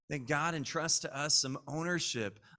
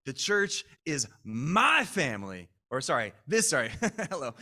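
normal talking voice

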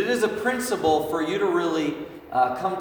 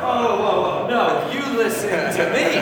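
higher, louder startled parent pushing back; taken aback parent; 5 semitones higher, 5 dB louder than usual. Retold or imitated. Pastor retelling his startled reply to his kid, higher and louder. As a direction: caught off guard, then insisting. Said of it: "And I was like".

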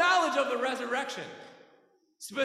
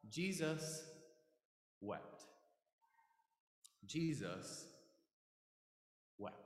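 very low and soft, with long pauses; hushed, solemn reading; 8 semitones lower, 11 dB quieter, slower than usual. Real, not acted. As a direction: let each word sink into silence. Solemn reading of Jesus weeping.

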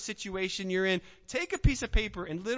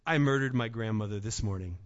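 ordinary delivery

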